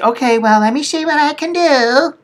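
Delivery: high sing-song imitation, pitch climbing; mock obliging clerk. Billing lady offering to check, high mock-obliging voice. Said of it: "And she said".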